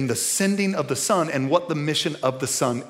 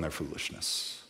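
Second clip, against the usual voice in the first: hushed; 11 semitones lower, 14 dB quieter than usual. Acted. Trailing off into a hush.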